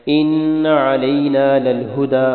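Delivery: ان علینا للہدی slow, the nun of ان held long through the nose; nasalized recitation. Shown as a demonstration. Teacher demonstrates ghunna for students, slow nasal hum. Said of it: "ان پہ نون پہ غنہ کرنا ہے".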